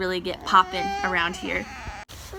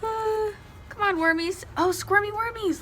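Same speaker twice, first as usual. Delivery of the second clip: put-on baby talk; 11 semitones higher than usual. Cooing at worms in bin.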